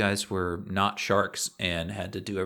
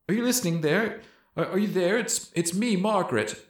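higher, desperate pleading; high begging voice; 5 semitones higher than usual. Acted. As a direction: beg with rising panic. Collar Margaret begs shark.

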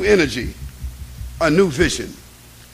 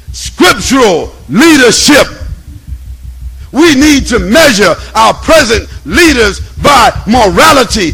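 loud, high, ringing preacher; thunderous preaching; 6 semitones higher, 7 dB louder than usual. Acted. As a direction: proclaim each word like a prophet. Chanted list of leaderships.

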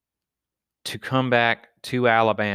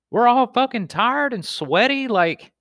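exasperated retort; 14 semitones higher than usual. Retold or imitated. Tired friends snapping back at the show-off, exasperated. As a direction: pitch jumps way up in mock exasperation.

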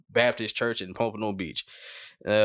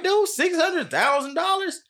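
much higher, slow disbelief; shocked disbelief, much higher and slow; incredulous; slower than usual. Real, not acted. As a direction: stretch it out in shock. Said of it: "I mean, bruh".

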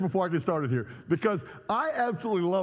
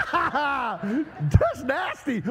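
laughing disgust; 5 semitones higher, much slower than usual. Real, not acted. Laughing at the crowd.

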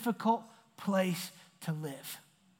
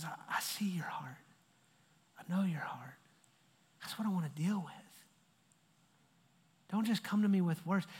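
gentle voice of God; 3 semitones lower, 7 dB quieter than usual. Retold or imitated. God speaking to the heart.

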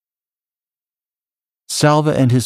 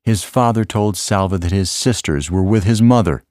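ordinary delivery, nothing marked

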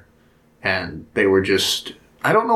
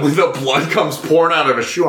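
ordinary delivery

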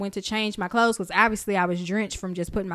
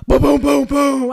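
loud, booming thunder noises; imitated thunder; 4 semitones higher, 9 dB louder than usual. Telling friend about the storm, loud thunder imitation. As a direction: boom it out like thunder.

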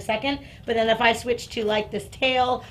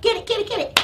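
high, rapid-fire urging; high, eager chant of prompts; working the dog into a frenzy; 8 semitones higher, much faster than usual. Real, not acted. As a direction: rev the dog up with repeats. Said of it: "I'm gonna make her crazy".

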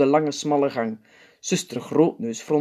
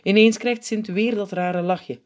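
plain reading voice

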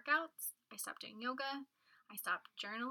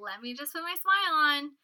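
high, loud, put-on brightness; fake cheer; 6 semitones higher, 11 dB louder than usual. Putting her smile on for her partner, fake cheer. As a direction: force a smile into the voice. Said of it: "I fake this, like, weird, like".

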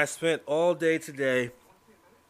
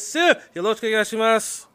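hyped-up greeting; 9 semitones higher, 6 dB louder, faster than usual. Acted. Hyped Japanese greeting to partner.

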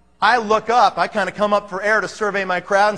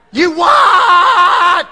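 loud, high, startled yell; shocked father-in-law bellowing; startled shout; 8 semitones higher, 9 dB louder than usual. Retold or imitated. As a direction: jolt awake and bark it out.

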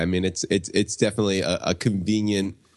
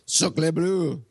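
high, cartoonish French exclamation; French accent; 9 semitones higher than usual. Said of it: "replace ... accents with French accents".

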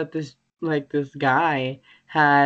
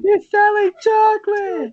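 put-on high, loud strained old woman cry; hammed-up frail mother shrieking; mock old mom cry; 6 dB louder than usual. Friend mocks the shriveled mom from SpongeBob, high loud wail.

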